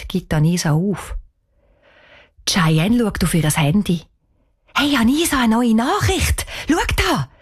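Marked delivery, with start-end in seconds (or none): 4.7-7.3 hoher, aufgeregter Zuruf; aufgeregte Meldung. A narrator's reading. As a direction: mach die Freundin ganz aufgeregt aufmerksam. Excited news of phone message. (aufgeregt und dringlich)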